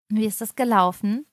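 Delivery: usual speaking voice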